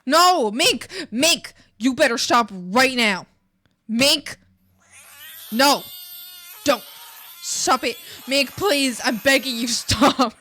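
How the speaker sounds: high, loud desperate pleas; frantic yelling. Acted. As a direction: beg and yell over the noise. Frantic yelling at screaming Mink.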